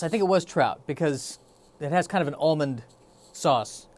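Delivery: usual speaking voice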